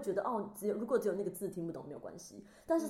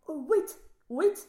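high, loud panicky "wait"; imitating someone thrown into a fluster; flustered clerk; 9 semitones higher, 8 dB louder, much slower than usual. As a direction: stall in mock panic, word by word. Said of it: "你害他们慌张了".

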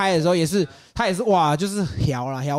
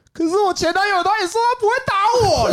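much higher mock girlfriend voice; imitating the girlfriend. Retelling what his date said in bed, high mock girlfriend voice. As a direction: squeak it out like the tearful girlfriend, way up high. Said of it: "他就说".

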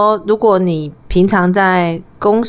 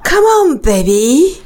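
sultry breathy come-on; 5 semitones higher than usual. Shown as a demonstration. Co-host tries the breathy come-on line on her man. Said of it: "用迷人的气音对你的男人说".

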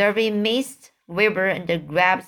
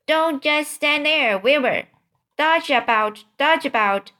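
higher-pitched, lightly acted commands; urgent orders; 7 semitones higher, 4 dB louder than usual. A narrator's reading. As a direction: call out orders as the goose, a little raised. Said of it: "began to give orders".

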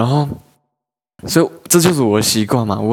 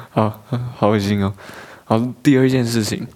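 ordinary talk, nothing marked

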